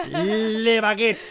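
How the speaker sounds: "Le baguette" drawn out very slowly; mock French. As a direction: stretch "Le baguette" with playful relish. Playful mock-French bread order. ("Le baguette" arrastrado, francés de broma)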